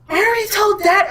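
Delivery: imitated daughter. Daughter dodges mom's question about feelings, high put-on voice.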